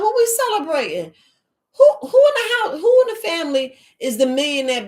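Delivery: high, loud, indignant challenge; heated retort. Retold or imitated. Challenging her family at a retold gathering, indignant and loud. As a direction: fire the question back, half laughing.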